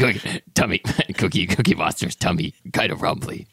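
halting puppet monster voice; Cookie Monster. Pretend. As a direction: play a monster talking about its belly, breaking into a laugh. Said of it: "say that in Cookie Monster's voice".

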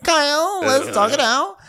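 very high put-on voice; mock consoling friend. Friend sitting with the upset groom, mock coaxing. Acting Lindsay consoling Kyle.

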